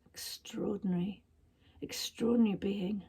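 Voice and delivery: soft breathless whisper in awe; hushed awed whisper. A narrator's reading. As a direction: breathe it in wonder, barely voiced. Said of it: "whispered Mina".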